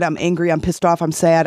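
ordinary delivery

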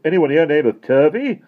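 high, loud calling question; inquiring caller. A narrator's reading. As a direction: call out to ask at the door. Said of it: "inquired a voice".

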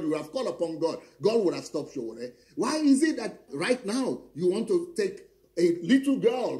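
usual speaking voice

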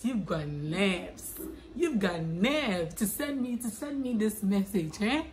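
slow, drawn-out mock indignation; Kennedy Japan. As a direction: stretch it out in an affronted impersonation. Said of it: "In Kennedy Japan's voice".

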